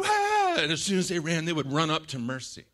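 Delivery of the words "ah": high, loud mimicked kid squeal; short imitated shriek; children's squeal. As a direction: squeal like a child fleeing the monster. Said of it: "and they would go".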